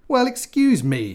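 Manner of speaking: high, loud, slow indignant spit; spat. Acted. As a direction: spit it back, offended. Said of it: "he spat".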